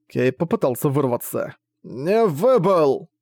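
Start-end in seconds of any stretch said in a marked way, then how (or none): 1.8-3.1 slow, strained, short of breath; running out of air. Acted. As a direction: squeeze it out, barely any air. Impaled man protests.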